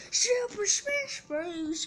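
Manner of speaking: slow, high, stretched hype yell; hype announcer shout. Pretend. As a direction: belt the title like an announcer.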